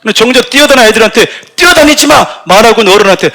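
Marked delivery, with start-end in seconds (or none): 1.6-2.2 sharp high shout of don't run; yelling at kids. Retold or imitated. Adult yelling at running children, sharp shout. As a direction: bark it at the kids like a scolding adult.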